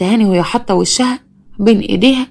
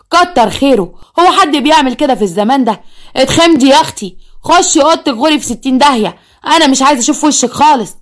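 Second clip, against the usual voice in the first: mother berating; 4 semitones higher than usual. Acted. Mother berates daughter, sends her away.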